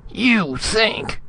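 very high, outraged echo; indignant splutter. A narrator's reading. Ambassador interrupting Retief, spluttering with outrage. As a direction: splutter it in high-pitched disbelief. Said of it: "Spradley spluttered".

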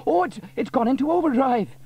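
high, loud stammering alarm; losing control of the machine; flustered panic. A narrator's reading. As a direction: inventor panicking.

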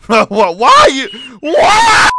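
loud yell rising very high; angry shout. Real, not acted. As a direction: bellow at the troll. Yelling at troll teammate.

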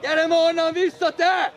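loud, high call across the pitch; shouted order. Real, not acted. Coach calling a player back, shouting.

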